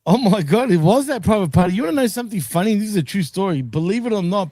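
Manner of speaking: ordinary talk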